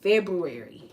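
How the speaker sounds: February said loud, full r, falling end. Shown as a demonstration. Insisting on the correct form, loud. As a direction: call out February with each syllable. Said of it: "That's the correct pronunciation".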